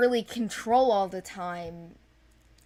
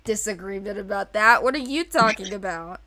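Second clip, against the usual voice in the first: laughing protest; 3 semitones higher, 10 dB louder than usual. Real, not acted. Laughing as she denies the co-host's joke, louder. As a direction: protest through giggles.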